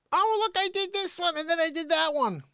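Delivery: high-pitched put-on voice; mocking imitation. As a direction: mimic him in a high, steady voice. Mimics someone in put-on voice.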